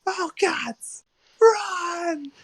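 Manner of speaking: thin, high falsetto cry; panicked scream. Acted. Player's character urges companions to flee, high-pitched mock scream. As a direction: squeal it very high without much volume. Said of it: "I just scream".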